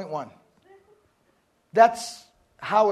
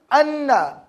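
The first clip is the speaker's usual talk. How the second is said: "anna" said with a louder push and falling tune; emphatic connector; 3 semitones higher, 5 dB louder than usual. Shown as a demonstration. Teacher contrasting two Arabic connectors, emphatic "anna". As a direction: lean hard on the doubled n of "anna". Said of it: "Anna is an emphasized sentence connector".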